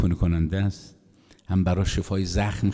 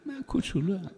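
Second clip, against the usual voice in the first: much higher; 12 semitones higher, 6 dB quieter than usual. A narrator's reading. High repeat.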